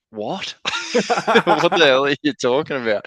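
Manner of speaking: high, loud, laughing disbelief; stunned disbelief. Retold or imitated. Player stunned by manager's Newcastle offer, laughing disbelief. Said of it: "And I just go".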